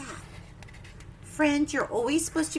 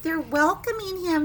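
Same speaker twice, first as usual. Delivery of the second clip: usual speaking voice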